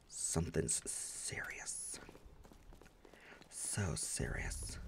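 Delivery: slow, hushed mock-serious whisper. Gamer whispering mock-seriously about the two-hander. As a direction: whisper it like grave news.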